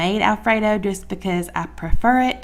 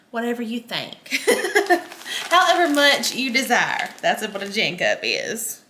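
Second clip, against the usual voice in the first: ordinary talk, nothing marked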